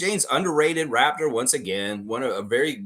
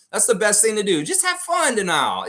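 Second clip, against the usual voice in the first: cheery encouragement; 10 semitones higher, 5 dB louder than usual. Real, not acted. Cheering on a viewer.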